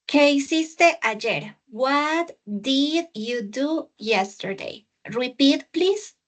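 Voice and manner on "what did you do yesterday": slow model reading. Shown as a demonstration. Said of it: "teacher models again slowly".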